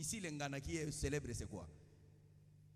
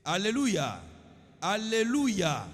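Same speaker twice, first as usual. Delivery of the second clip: loud sermon call to the congregation; full-force preaching; 5 semitones higher, 11 dB louder than usual. Acted. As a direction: rouse the crowd for a response.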